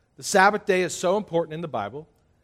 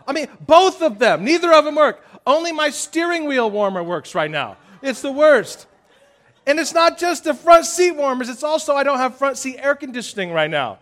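raised, laughing mock grievance; preacher jokes about his car woes, higher and louder; mock complaint; 9 semitones higher, 7 dB louder than usual. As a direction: whine it up for laughs. Continues comic complaint.

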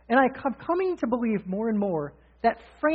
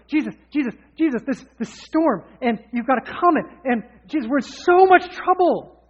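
panicky, rising higher and louder to a near-shout; frantic pleading; 4 semitones higher, 6 dB louder than usual. Retold or imitated. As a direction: beg in mounting panic, like the boat is sinking. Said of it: "when we walk into Jesus' presence all frantic".

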